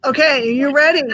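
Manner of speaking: normal talking voice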